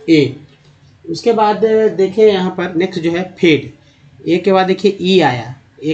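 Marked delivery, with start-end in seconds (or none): 0.1-0.4 ए said as a clear "ay" sound; teacher giving the vowel ए as "ay"; vowel answer. Shown as a demonstration. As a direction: answer with ए as a clean "ay". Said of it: "ए का उच्चारण क्या होगा?".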